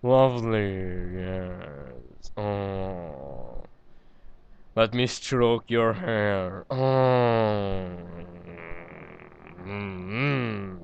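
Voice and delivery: lovingly slow pet talk; tender cooing. Acted. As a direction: coo over the pony slowly. Cooing over a pet pony.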